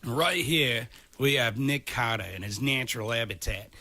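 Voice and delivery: hushed-excited Aussie nature presenter, cranked up; Steve Irwin Australian accent. Acted. Narrating his co-host as a wild animal in a mock Australian accent. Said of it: "stereotypical Steve Irwin cranked up to eleven kind of Australian accent".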